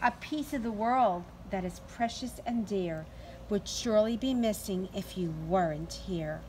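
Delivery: plain reading voice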